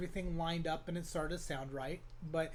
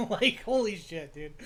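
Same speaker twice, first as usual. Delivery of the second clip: higher, louder, breaking into a laugh; laughing disbelief; 4 semitones higher, 8 dB louder than usual. Retold or imitated. Reacting to his breakcore struggle, laughing disbelief. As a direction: exclaim it through a chuckle.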